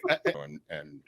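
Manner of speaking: ordinary talk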